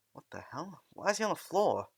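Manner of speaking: baffled rising outcry; confused, voice jumping up; startled. Acted. Students startled by teacher on floor.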